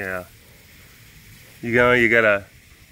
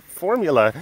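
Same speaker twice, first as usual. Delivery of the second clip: exclaiming; 5 semitones higher, 4 dB louder than usual. Real, not acted. Echoing the word excitedly.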